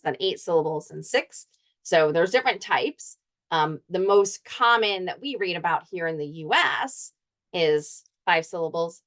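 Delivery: usual speaking voice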